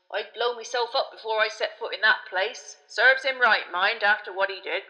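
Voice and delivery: loud, grimly emphatic driver; slow-voiced driver. A narrator's reading. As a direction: state it heavily, with conviction. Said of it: "said Ern in his slow voice".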